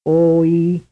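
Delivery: loud, two vowel beats; two-vowel sequence. Shown as a demonstration. Teacher quizzing students. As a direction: sound two separate vowels. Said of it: "The answer is A, a two-vowel sequence".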